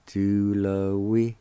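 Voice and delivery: "tú lo wi" slowly, as the first part; broken-down chunk. Shown as a demonstration. Teacher breaking a long word down, slow chunk. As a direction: draw out tú lo wi as a separate piece. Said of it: "break those two words down".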